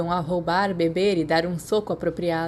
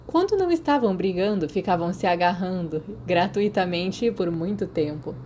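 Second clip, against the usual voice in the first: ordinary delivery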